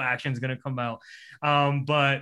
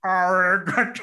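arigato higher and louder in a silly slurred voice; teasing imitation of the wolf; mock wolf arigato; 5 semitones higher, 6 dB louder, slower than usual. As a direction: playfully parrot the wolf's arigato at your girlfriend. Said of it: "we'd say thank you to each other".

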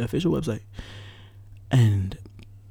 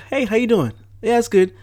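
much higher, louder, light polite greeting; stranger voice; 12 semitones higher, 7 dB louder than usual. Shown as a demonstration. Showing how he talks to a stranger, high and polite. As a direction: put on your friendly voice for a stranger. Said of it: "me talking to some stranger, I'm like".

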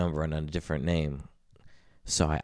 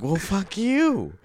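drawn-out, much higher, slow comeback; mock indignant comeback; 14 semitones higher, 3 dB louder, much slower than usual. Friend retelling his text comeback, slow high mock outrage. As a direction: stretch it out high and offended.

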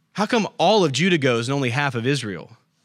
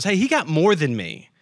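put-on high, petulant kid whining for more; childish whine, pitched up; whiny sibling; 7 semitones higher than usual. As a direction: play the jealous little brother begging. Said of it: "the sibling that looks over at their brother or sister and goes".

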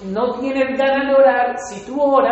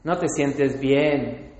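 slow low tempter; 8 semitones lower, 4 dB quieter, much slower than usual. Retold or imitated. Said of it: "que Satanás te dice es".

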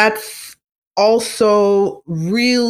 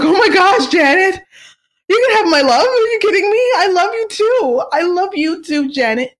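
screaming fangirl; 12 semitones higher than usual. Pretend. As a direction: squeal it like an overwhelmed fan. Fan gushing back at Janet.

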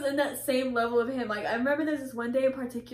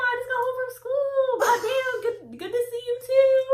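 high-pitched, hammed-up indignant greeting; acted sarcastic protest; 11 semitones higher, 3 dB louder than usual. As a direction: protest in a mock-offended high voice. Sarcastic reply to yelling grandma.

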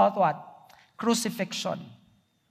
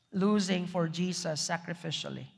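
Losing for Jesus sacrificially repeated much softer; 5 semitones lower, 10 dB quieter than usual. Shown as a demonstration. Repeating the point to the congregation, softer and lower. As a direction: let Losing for Jesus sacrificially sink in, quietly.

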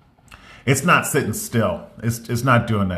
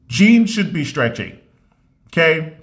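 louder and higher, insisting; emphatic rant; 6 semitones higher, 4 dB louder than usual. Real, not acted. Ranting to viewers about jeans, emphatic and loud.

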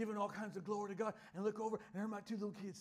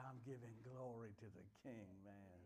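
soft low reply; 9 semitones lower, 11 dB quieter than usual. Retold or imitated. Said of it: "I said".

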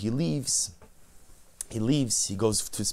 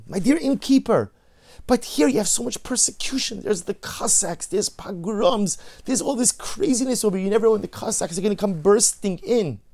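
higher and louder, urgently pleading; 8 semitones higher, 5 dB louder than usual. Acted. The Rebbe urging Yankel the innkeeper to leave the Cossacks behind, in higher, louder, urgent pleading. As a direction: press him hard to see the danger.

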